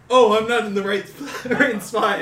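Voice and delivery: high, loud acted realization; mock alarm. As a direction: imitate someone suddenly realizing their mistake, half laughing. Acting out someone standing in wrong place.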